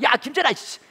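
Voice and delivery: high-pitched scolding; angry dad. Retold or imitated. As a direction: lose patience and order the child. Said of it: "화를 내면서 얘기를 했죠". (화를 내며 아이를 야단치듯)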